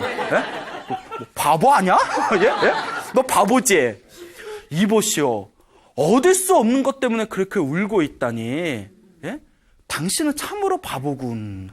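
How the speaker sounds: 어이없다는 듯 비웃으며 꾸짖기; scornful disbelief. Retold or imitated. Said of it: "구두쇠가 어이가 없는 거예요". (황당한 구두쇠가 젊은이를 비웃으며 꾸짖는 말투)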